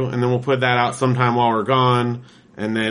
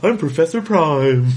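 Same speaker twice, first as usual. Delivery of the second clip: slow, higher cartoon mascot; 4 semitones higher, 3 dB louder, slower than usual. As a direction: introduce yourself like a cereal mascot. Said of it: "Stop doing that".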